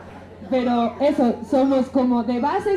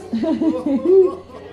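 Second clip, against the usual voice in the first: laugh; 4 dB louder than usual. Real, not acted. Asked how the group organizes.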